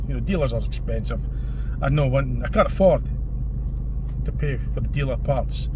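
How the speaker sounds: ordinary talk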